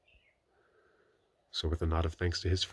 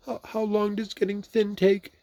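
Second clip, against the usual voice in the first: higher, stammering worry; anxious; 6 dB louder than usual. A narrator's reading. Stuck Pooh asking Christopher Robin, anxious. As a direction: fret about it, faltering.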